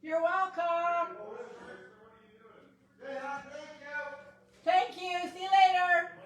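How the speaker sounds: high, bright call across the room; high called farewells; calling out goodbye. Real, not acted. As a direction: sing out goodbyes to departing guests. Calling goodbye to leaving guests.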